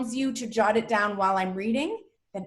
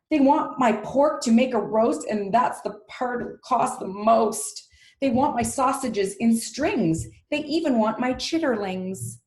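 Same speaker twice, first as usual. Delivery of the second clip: emphatic, raised character reading; indignant storybook pig; 4 semitones higher, 3 dB louder than usual. Acted. Pig lists what they want from him, indignant reading. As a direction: declaim each grievance as the pig. Voices the pig's speech.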